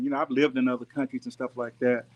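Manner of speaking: normal talking voice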